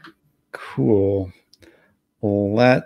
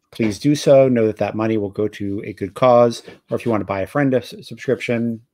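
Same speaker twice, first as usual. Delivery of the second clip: ordinary talk, nothing marked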